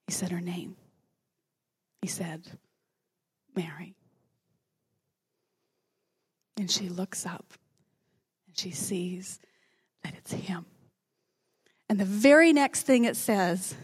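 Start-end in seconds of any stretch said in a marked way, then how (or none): 0.1-10.7 awed whisper. A narrator's reading. Preacher telling how Jesus says Mary's name, whispered. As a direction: hold the room in a hush.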